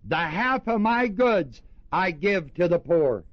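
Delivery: declaring to the Lord. Retold or imitated. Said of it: "said unto the Lord".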